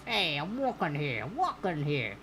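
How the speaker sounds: put-on New York accent. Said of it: "if that was New Yorkian enough".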